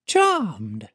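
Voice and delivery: high icy voice; female auditor. A narrator's reading. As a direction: say it with frosty politeness. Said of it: "says the female auditor".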